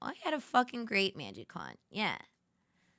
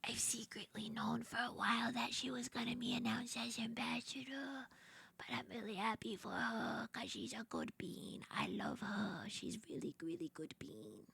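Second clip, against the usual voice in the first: unvoiced, much softer secret whisper; 14 dB quieter than usual. Real, not acted. Streamer confiding to chat she knew early, whisper.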